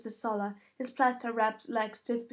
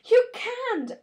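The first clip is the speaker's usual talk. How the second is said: much higher, loud outburst; girl protesting being sent home; protesting cry; 13 semitones higher, 9 dB louder than usual. A narrator's reading. Daughter protesting being sent away.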